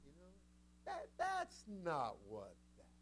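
lightly voiced, slow doubting follower. Preacher imitating followers who doubt Jesus, lightly mocking excuse. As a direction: drawl out the excuse half-heartedly. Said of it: "now you can imagine some of them".

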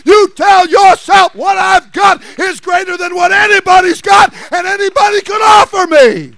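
loud, high preaching shout, building; full-force preaching. Real, not acted. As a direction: build to a driving sermon climax.

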